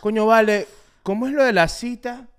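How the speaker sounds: loud, high sudden interest; mock tempted viewer. Co-host parodying the third Vision Pro video, loud eager curiosity. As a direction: act out someone suddenly wanting one. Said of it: "Tercer video de Vision Pro".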